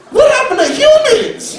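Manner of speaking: exasperated cry. Real, not acted. Pleading with the crowd about lost humanity, laughing outcry. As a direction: throw up your hands and cry out, half laughing.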